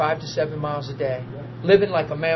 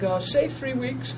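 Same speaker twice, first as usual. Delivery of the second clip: amused; 5 semitones higher, 7 dB quieter, slower than usual. Retold or imitated. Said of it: "I'm like".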